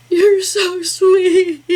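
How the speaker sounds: high, slow, tearful squeak; barely getting the words out through tears; choked-up crying. Retold or imitated. Thanking daughter for the gift deer, crying high and slow. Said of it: "I'm, I'm just bawling".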